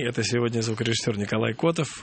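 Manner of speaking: ordinary talk, nothing marked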